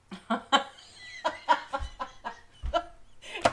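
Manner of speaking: long airy giggle rising in pitch; delighted laugh. Real, not acted. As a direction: burst into a breathy delighted laugh. Delighted at card drawn.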